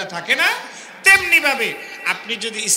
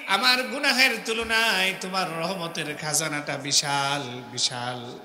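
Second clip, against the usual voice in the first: pleading prayer; 4 semitones lower than usual. Acted. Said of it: "আসামির মতো হয়ে আল্লাহর কাছে মাপ চাইতে হবে". (নরম নিচু গলায় কাতর মোনাজাত)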